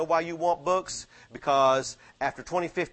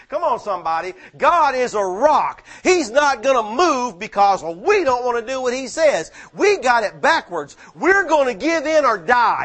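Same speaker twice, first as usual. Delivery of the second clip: louder, higher, hammering each phrase; preaching at full force; 6 semitones higher, 7 dB louder than usual. Acted. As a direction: thunder it at the congregation.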